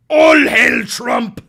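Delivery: slow, very loud crying shout of a zealot; mock rally scream, slow and huge. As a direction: bellow it like a crazed cult rally. Mock fanatic chant, louder.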